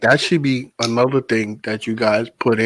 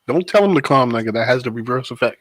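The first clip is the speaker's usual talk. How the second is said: normal talking voice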